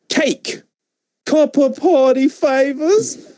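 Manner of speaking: high, slow mocking drawl; mocking Enzo voice. Callback to Enzo mimic.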